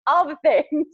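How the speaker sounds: laughing through the words; giggling it out. Real, not acted. Laughing while wrapping up.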